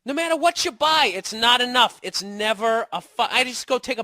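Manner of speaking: loud, high-pitched frustration; exasperated ranting. Acted. Man griping about his battery-draining girlfriend, exasperated outburst. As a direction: rant it out, voice pushed up high.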